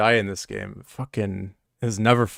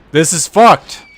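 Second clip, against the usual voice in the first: furious, high and loud; angry shout; 7 semitones higher, 13 dB louder, slower than usual. Real, not acted. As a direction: yell it out in real anger. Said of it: "I'm so mad right now".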